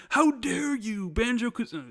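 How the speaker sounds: high, slow mock outrage of an angry fan; hammed-up how dare you; mock outraged fan. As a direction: play the offended fan, drawn out and high. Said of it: "My mentions would be flooded being like".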